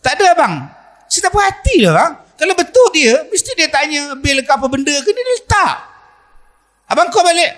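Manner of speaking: high insistent wife; suspicious wife. Acted. Wife insisting her husband call back, high and suspicious. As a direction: press him, high and unconvinced.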